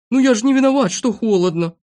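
high-voiced little character protesting; whiny self-defence. A narrator's reading. Shivering friend answers the grumbler, protesting.

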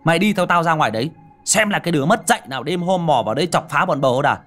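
loud, fast, furious defiance; angry growl. Acted. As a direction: bark it out to hide the fear. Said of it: "giàn gằn giọng". (gằn giọng giận dữ, nói to và nhanh)

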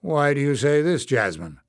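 firm challenge, falling on the name; demanding. A narrator's reading. Bada questions Jasmine's urgency, demanding. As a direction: demand an explanation. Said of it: "Bada demanded".

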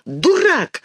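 very high indignant outburst; angry little girl, high-pitched; flaring up. A narrator's reading. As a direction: fire it back, pitch leaping. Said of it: "вспыхнула Динка".

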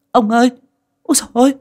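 high, loud frantic cry to her husband; panicked scream. Acted. Mother screaming at her collapsed husband, panicked high cry. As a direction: scream in terror, voice way up. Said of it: "Mẹ hét lên".

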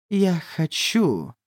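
slowly, word by word; slow speech. Acted. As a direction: draw the words out slowly. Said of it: "Джей медленно заговорила".

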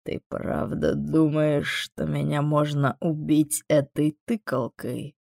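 slow, unruffled demon voice; measured and calm; calm inquiry. A narrator's reading. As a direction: ask it slowly, quite untroubled. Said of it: "Спокойно поинтересовался Амон".

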